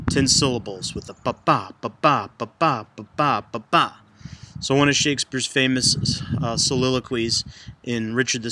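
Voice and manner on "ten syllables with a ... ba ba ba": "ba-ba" pairs, stress on the second "ba"; iambic beat. Shown as a demonstration. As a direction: chant "ba-ba" as unstressed then stressed. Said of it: "that beat".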